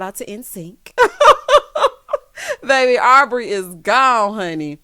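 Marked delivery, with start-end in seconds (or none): 1.0-2.6 loud burst of high laughter. Real, not acted. Vlogger laughing at Aubrey's exit, loud laugh. 2.6-4.8 much louder, slow and laughing. Real, not acted. Vlogger laughing that Aubrey left, laughing loudly.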